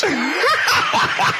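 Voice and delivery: high laughing shout; real helpless laugh; shrieking laugh. Real, not acted. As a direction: burst out laughing. Laughing at the band name.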